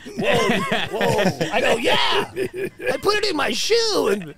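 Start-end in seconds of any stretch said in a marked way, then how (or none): 1.8-4.1 higher, loud, laughing brag; proud excited boast. Retold or imitated. Telling Doc about the hash in his boot, loud proud brag. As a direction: shout it proudly like a clever trick.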